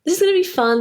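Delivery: high, bright pep talk to each other; forced excitement. Retold or imitated. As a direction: talk yourselves into it, pitch rising. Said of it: "And we were like".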